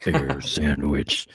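slow, low voice breaking into a laugh; drawn-out low trailer voice; deep announcer voice. Acted. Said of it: "I like the sound of it".

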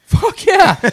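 high, loud eager whoop; excited yell. Retold or imitated. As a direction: shout it like a thrilled fan. Eager reply to smoke offer.

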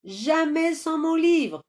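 put-on high-pitched child calling out; little boy yelling back, high voice; boy's defiant cry. A narrator's reading. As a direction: cry out in stubborn refusal. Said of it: "cria le garçon".

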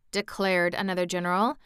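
usual speaking voice

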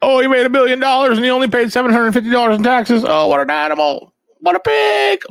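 mocking Trump critics in a high whiny voice. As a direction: parody the people scolding Trump. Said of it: "Everybody talks shit on Donald Trump".

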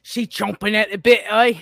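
punchy Australian bloke; Australian accent. Acted. Teasing partner in the croc hunter bit, loud Australian accent. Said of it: "the accent change?".

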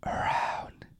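slow, airy whisper; imitated ad whisper. Co-host mimicking the cinema intro, slow whisper. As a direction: whisper it with long gaps between words.

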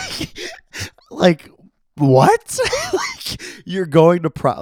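baffled outburst broken by laughter; laughing disbelief. Real, not acted. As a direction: crack up in real disbelief. Laughing disbelief at prom story.

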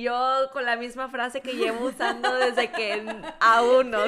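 laughing while she talks; giggling at herself. Real, not acted. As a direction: laugh through the words. Laughing at her own mistakes.

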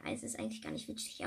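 ordinary delivery

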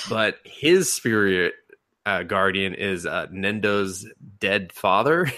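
ordinary talk

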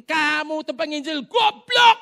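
loud slow insult, high and furious; angry shout. Retold or imitated. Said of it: "Dia ngamuk-ngamuk". (bentakan marah keras)

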